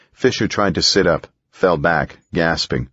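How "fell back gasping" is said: usual speaking voice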